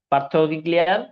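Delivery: normal talking voice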